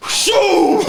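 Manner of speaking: high whooshing shoo; a quick whoosh of liquid; mock spray whoosh. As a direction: make the spray shoot up. Imitating Drano spraying up.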